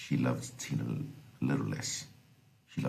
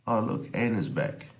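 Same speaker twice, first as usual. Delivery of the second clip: high-pitched imitation of relatives announcing someone; quoted household chatter, voice lifted high; retold family remark; 9 semitones higher, 3 dB louder than usual. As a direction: lightly mimic a relative's exclamation, pitched up high.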